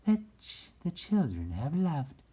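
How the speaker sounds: high, loud, bright fairy voice; high sweet fairy speaking to the rabbit. A narrator's reading. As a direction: lift it way up into a fairy's voice. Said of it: "she said".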